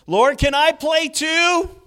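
put-on high, loud, slow eager kid voice; childlike plea. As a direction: beg like a kid wanting in on the game.

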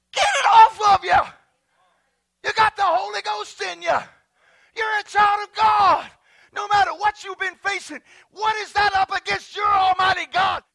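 high, loud preaching at full force; forceful, exhorting pulpit shout; shouted rebuke. Real, not acted. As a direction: shout the gloom off them.

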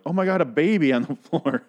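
high hammed-up alarm breaking into a laugh; mock shock. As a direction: exclaim in exaggerated astonishment, amused. Imagined shocked reaction to a baby.

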